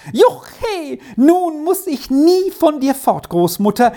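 put-on girl cheering to her grandmother; jubilant cheer. A narrator's reading. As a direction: whoop with joy like a little girl. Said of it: "Trini jauchzte laut auf".

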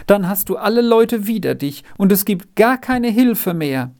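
high, plaintive warning; lamenting. A narrator's reading. Margretli warns Renz everyone will turn against him, lamenting. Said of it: "klagte es".

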